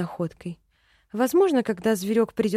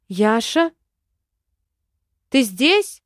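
calling out loudly; 5 dB louder, slower than usual. A narrator's reading. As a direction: call out into the forest. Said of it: "крикнула я". (громкий оклик)

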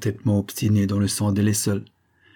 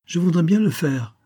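put-on young boy voice; 8 semitones higher than usual. Boy baronet speaks to major.

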